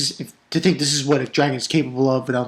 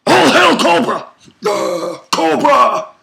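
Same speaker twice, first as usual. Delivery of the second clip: loud, very high hammed-up chant; mock rallying shout; 9 semitones higher, 5 dB louder, slower than usual. Fan hailing Cobra in a playful outburst, mock rallying shout. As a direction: yell it like a cartoon henchman.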